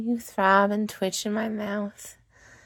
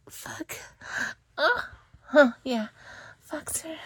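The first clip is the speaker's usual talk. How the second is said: breathless pleading; 7 semitones higher than usual. Acted. Close to climax, she begs her partner in a high hushed voice. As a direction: beg him, voice pitched up and hushed.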